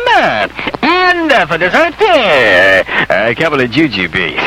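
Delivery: mock maitre d' announcing menu. Host describing fake Christmas dinner, mock grand. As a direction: present each dish like a fancy waiter. Menu read in posh waiter manner.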